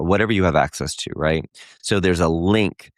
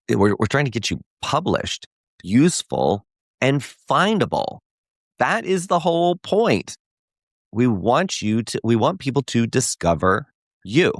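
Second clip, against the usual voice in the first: higher, passionate push, climbing as the point lands; fired-up soapbox push; 6 semitones higher than usual. Real, not acted. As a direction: preach it from the soapbox. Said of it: "I got very aggressive there".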